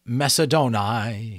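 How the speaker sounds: absent-minded intoning. A narrator's reading. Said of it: "he intoned absent-mindedly".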